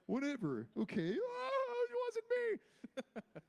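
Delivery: high, slow, wailing sob-voice; mock crying. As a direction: drag out a hammed-up audition sob. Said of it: "because I was like".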